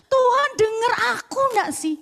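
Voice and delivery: complaining. Retold or imitated. Retelling her complaint to God.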